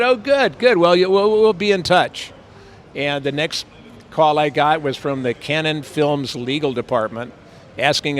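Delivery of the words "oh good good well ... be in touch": higher, louder glad voice; hammed-up relief wrapping up; mock pleased writer. Kit Carson ends the call with Bill, higher glad voice.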